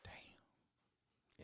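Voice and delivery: breathless whisper of awe; whispered damn. Real, not acted. Reacting to his friend's eight-year relationship, whispered. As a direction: breathe it out, impressed.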